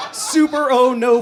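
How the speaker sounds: high, loud mock dread; shrill terrified oh no; horrified. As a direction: squeal oh no in horror. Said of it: "I'm like".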